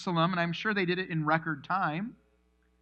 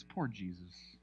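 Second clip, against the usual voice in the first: poor; 10 semitones lower, 13 dB quieter, slower than usual. Real, not acted. Pitying aside.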